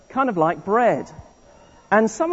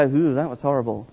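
mock disgust; 6 semitones lower, 5 dB quieter than usual. Shown as a demonstration. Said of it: "look at Egypt and go".

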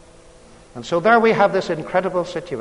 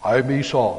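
lightly lowered voice, a hint of faking his brother; deepest voice he could; 5 semitones lower than usual. A narrator's reading. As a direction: put on a slightly deeper voice to pass as Esau. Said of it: "in the deepest voice he could get up".

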